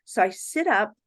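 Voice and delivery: ordinary delivery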